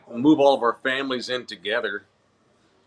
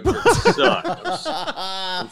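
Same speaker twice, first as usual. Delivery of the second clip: laughing; 13 semitones higher, slower than usual. Real, not acted. Laughing punchline.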